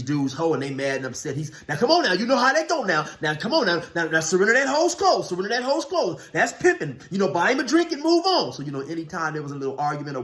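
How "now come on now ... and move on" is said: high-pitched put-on peacemaker voice; exaggerated high mimicry of a fast-talking mediator; Fruit. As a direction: imitate a smooth talker settling a dispute, pitched way up and loud. Acting out Fruit settling an argument.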